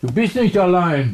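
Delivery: high, loud insistence; exasperated reassurance. Acted. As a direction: raise the voice to argue back. Answering the other's protest.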